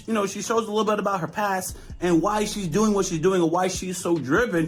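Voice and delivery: ordinary delivery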